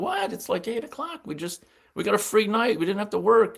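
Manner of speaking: high-pitched imitated disbelief; incredulous protest. Beat writer friends objecting to him leaving, incredulous protest.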